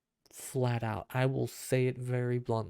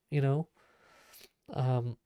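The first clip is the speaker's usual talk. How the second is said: usual speaking voice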